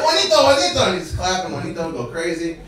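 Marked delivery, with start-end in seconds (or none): 0.0-0.8 high, loud call across the yard. Retold or imitated. Blanco calling his rooster with a loud, high call.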